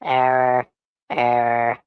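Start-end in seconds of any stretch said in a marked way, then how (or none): none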